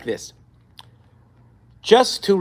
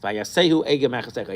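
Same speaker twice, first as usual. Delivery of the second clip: ordinary delivery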